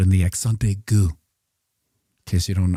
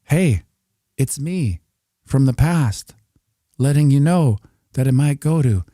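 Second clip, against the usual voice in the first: high, playful call to the future; raised pitch, stretching out the ending; message from past self; 6 semitones higher, 3 dB louder, slower than usual. Pretend.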